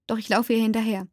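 usual speaking voice

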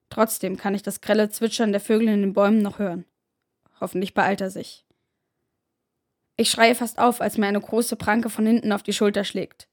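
plain reading voice

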